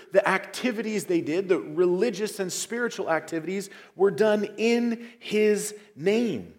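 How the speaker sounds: preaching at full force. Acted. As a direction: drive home the emphasis.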